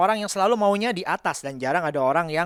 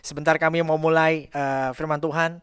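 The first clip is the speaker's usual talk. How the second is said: opening prayer; 4 dB louder than usual. Acted.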